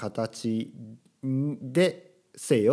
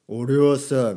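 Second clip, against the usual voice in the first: macho guy voice; 3 semitones higher, 9 dB louder, much slower than usual. Pretend. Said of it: "すごい男っぽく喋る人もいれば".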